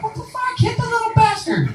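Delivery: a squealing what-the-fuck at soft parents; outraged squeal. Retold or imitated. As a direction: squeak it out in shocked disbelief. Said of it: "I'm like".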